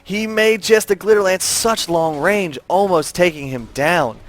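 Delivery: urgent, excited esports casting; live play-by-play. Real, not acted. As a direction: ride the rising action of a team fight.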